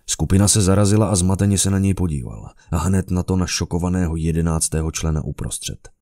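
usual speaking voice

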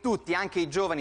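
ordinary talk, nothing marked

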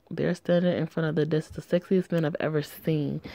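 ordinary delivery

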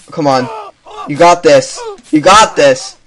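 ordinary talk, nothing marked

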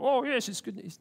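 high-pitched fake smiley gushing; manufactured cheer. Preacher mocks manufacturing a smile about good news, fake cheer. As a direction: put on a forced smile, voice jumping up. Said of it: "not about manufacturing smile".

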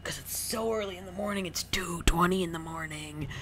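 high breathy delirious whisper. Real, not acted. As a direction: whisper it like you've lost your mind. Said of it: "I'm fucking losing my mind right now".

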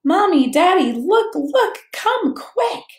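put-on high, loud children calling out; children shouting with glee; excited shouting. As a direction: call the parents over in excitement. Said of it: "they began shouting".

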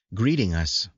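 plain reading voice

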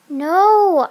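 loud, high drawn-out no; insistent refusal. Acted. Child won't let friend sleep, loudly insistent. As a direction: whine it out, refusing to give in.

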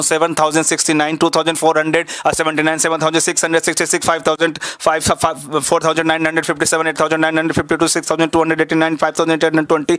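fast, even run of big numbers; rapid number recital. Shown as a demonstration. Showing the class he can say any number, rapid recital. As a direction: reel the numbers off quickly without pausing. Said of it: "इस number को मैं ऐसे ही, ऐसे ही बोल सकता हूँ".

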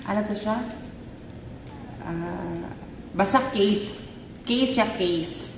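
usual speaking voice

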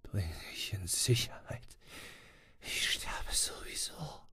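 voiceless dying whisper. A narrator's reading. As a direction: breathe it out, resigned to death. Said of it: "flüsterte Swanson".

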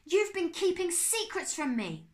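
high, loud, slow fury; a furious boy accusing his uncle; angry accusation. Acted. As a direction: grind out each word, shaking with anger. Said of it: "He was so angry".

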